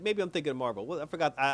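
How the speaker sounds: ordinary talk, nothing marked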